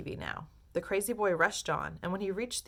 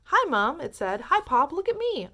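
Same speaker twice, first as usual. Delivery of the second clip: much higher, tiny mouse voice; tiny squeaky voice; 9 semitones higher, 8 dB louder than usual. A narrator's reading. Shrunken Mike calling from the screen, tiny and high. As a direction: squeak like a little figure. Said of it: "a tiny little voice".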